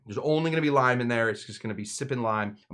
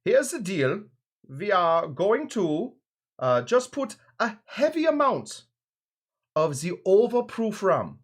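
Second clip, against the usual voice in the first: high-pitched, hammed-up effeminate German accent; 6 semitones higher, 4 dB louder than usual. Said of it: "Let's do the effeminate German".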